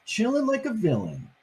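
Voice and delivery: plain reading voice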